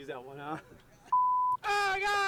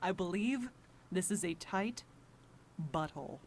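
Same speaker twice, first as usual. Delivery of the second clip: deep voice; 11 semitones lower, 7 dB quieter than usual. Acted. Voice actor's deep line read.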